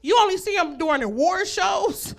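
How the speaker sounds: ordinary delivery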